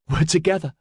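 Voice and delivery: high-pitched show of courage; valiant reassurance. A narrator's reading. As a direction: stand bravely by a companion. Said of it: "she said valiantly".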